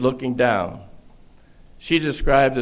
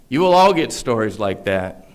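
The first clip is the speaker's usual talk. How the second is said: usual speaking voice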